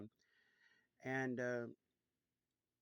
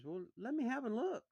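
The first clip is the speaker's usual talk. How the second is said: put-on much higher, louder, keen girl's voice; eager sister; 10 semitones higher, 4 dB louder than usual. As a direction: jump at the offer.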